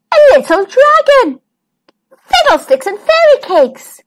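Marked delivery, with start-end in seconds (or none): 2.3-4.0 exclaiming. Acted. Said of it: "exclaimed Twinkle".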